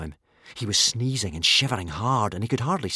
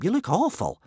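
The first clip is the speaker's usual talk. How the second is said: higher, concerned exclamation; worried; 9 semitones higher than usual. A narrator's reading. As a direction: voice lifted in concern for a friend. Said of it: "worried Hiccup".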